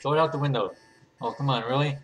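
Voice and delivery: ordinary talk, nothing marked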